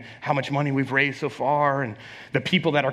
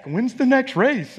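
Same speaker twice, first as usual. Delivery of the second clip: much higher, slow eager question; imitating the community pestering him about the race; eager asker; 10 semitones higher, slower than usual. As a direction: ask like an excited neighbour. Community asking about races.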